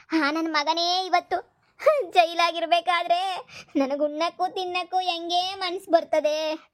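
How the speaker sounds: slow, drawn-out tearful wail; put-on crying. Mother begs for jailed son's release, slow feigned weeping. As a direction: plead as if sobbing, dragging the words. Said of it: "ಈಗ ನೋಡ್ರೆ ಅಳ್ತಾ ಇದೆಯಲ್ಲ".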